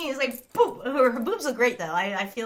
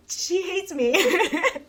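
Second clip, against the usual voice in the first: high, giggly admission; amused, higher voice breaking into laughs; laughing; 6 semitones higher, 4 dB louder, much slower than usual. Real, not acted. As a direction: burst into laughter as you say it. Laughing about her therapist.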